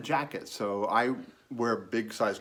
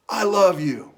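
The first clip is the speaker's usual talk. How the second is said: high, loud imitated call; heartfelt call; 7 semitones higher, 9 dB louder, slower than usual. Young man calling from car to departing stranger, heartfelt call. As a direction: call it out across a distance.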